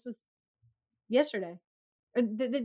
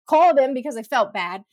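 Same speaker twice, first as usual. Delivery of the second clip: ordinary delivery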